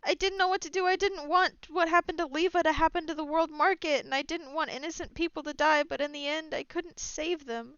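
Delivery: high, strained and near tears; grief-stricken confession; tearful. Acted. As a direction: hold back sobs as it spills out. Captain confesses her failure, crying.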